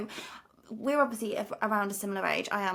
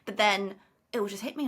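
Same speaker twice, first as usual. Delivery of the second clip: ordinary talk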